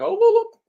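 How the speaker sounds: high, loud mock whoop; imitated whooping. Mimics whooping to clarify Indian.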